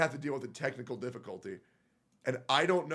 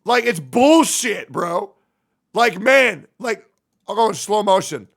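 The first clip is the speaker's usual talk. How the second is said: high, very loud, angry ranting; mad shouting; 10 semitones higher, 14 dB louder, slower than usual. Real, not acted. Streamer raging to viewers about a fly ruining play, furious shouting. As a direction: shout it in real outrage, pitch pushed way up. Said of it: "bro, I'm so mad".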